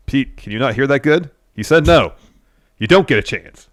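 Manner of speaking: pointed, emphatic put-down; mock taunt. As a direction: rub it in, imitating a wrestler's promo. Acts out Trick's taunt to Pete.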